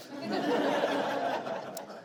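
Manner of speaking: high airy chuckle; breathy laugh. Real, not acted. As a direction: laugh with delight. Laugh after good offer.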